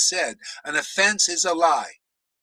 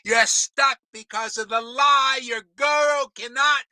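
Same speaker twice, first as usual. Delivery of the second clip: yelling; 6 semitones higher than usual. Real, not acted. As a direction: shout it at the crowd of followers. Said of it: "Do I have to yell more?".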